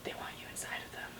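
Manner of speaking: creepy hushed whisper. Acted. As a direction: whisper it ominously.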